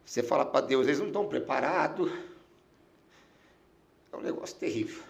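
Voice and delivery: real emotion, low and soft, long halting pauses; fighting back tears, voice dropping away; choked with emotion. Real, not acted. Pastor recalling dying thoughts of his family, choked up. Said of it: "até me emociona".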